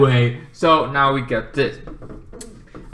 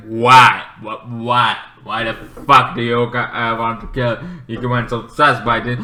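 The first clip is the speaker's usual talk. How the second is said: ordinary talk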